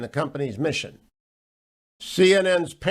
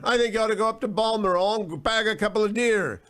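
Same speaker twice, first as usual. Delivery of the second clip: lightly voiced royal life coach, higher; a hint of a prince giving advice, pitched up; Prince Harry; 5 semitones higher than usual. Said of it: "That's what he's going to recommend".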